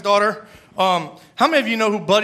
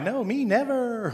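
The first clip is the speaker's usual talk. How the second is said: softer, slow, faux-innocent denial; mock denial; 3 semitones higher, 6 dB quieter, slower than usual. A listener denying a lie, softly faux-innocent. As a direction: play innocent, slowly and softly.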